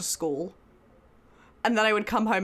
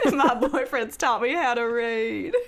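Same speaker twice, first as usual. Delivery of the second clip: put-on Southern accent. Retelling the movie's plot to Clammy, mock Southern accent. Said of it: "her little Where the Crawdads Sing Southern accent".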